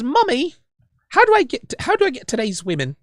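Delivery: high-pitched little British boy, hammed up; terrible British accent. Host playing a son asking mum about women, terrible British accent. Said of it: "In my terrible British accent".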